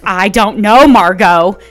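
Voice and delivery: "know" punched out loud in a quoted retort; loud retort leaning on "know"; stress on know. Acted. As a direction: hit "know" hard, exasperated movie-husband style. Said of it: "The emphasis on no".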